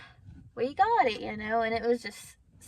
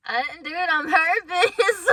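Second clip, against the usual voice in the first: high, loud comeback tipping into laughter; mock-innocent protest; 7 semitones higher, 9 dB louder than usual. Answering a friend about the matching car seat, playful protest.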